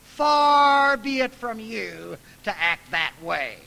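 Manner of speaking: slow, loud rebuke; scolding God. Retold or imitated. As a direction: scold God firmly and deliberately. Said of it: "he scolds God".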